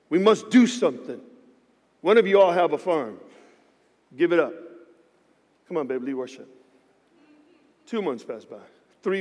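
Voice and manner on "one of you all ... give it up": ordinary talk, nothing marked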